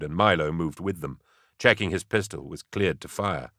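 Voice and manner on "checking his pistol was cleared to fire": ordinary delivery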